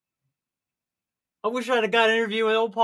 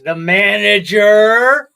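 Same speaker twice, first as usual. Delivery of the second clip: dramatic; 9 dB louder, much slower than usual. Acted. Dramatic guess at the twist.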